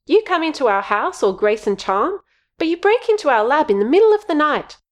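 high, heated accusation; accusing. Acted. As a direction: snap at the intruder, rising. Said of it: "pointed accusingly".

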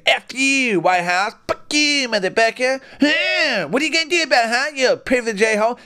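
hammed-up furious jeering; mock-furious cursing at the privileged house; mock angry taunt.

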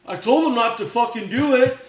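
loud, high mock outburst; indignant protest. Shooter shifting blame to Coop's face, loud indignant protest. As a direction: protest your innocence, hammed-up.

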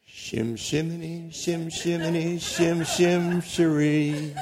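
slow, soft goofy mangling of the name Shimei; silly voice. Pretend. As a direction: play with the name slowly and quietly, half laughing. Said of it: "You don't like my voice?".

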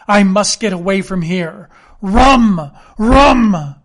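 loud, rising desperate demand for rum; desperate cry. A narrator's reading. Reeling captain shouting at Jim for rum, desperate yell. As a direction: yell it as your strength goes.

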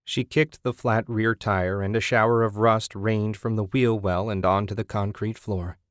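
plain reading voice

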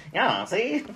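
high-pitched mock gangster; old-time gangster voice. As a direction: play an old movie mobster. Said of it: "he does it in that voice".

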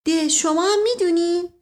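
innocent, mildly surprised query; naive surprise. A narrator's reading. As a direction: ask it simply, lifting the pitch like a guileless maid. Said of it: "فاتی با سادگی گفت".

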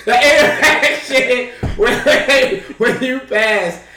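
helpless laughter, high and slow; laughing while talking. Real, not acted. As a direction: drag the words out through laughter. Cracks up while resuming the story.

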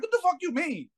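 high-pitched mock indignation; retold indignant woman. Woman challenged on a jet ski, high mock indignation. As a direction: imitate her baffled comeback, pitched right up. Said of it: "She was like".

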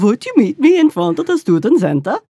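hammed-up, sing-song Dutch caricature; over-the-top Dutch accent. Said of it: "that's cliche over the top".

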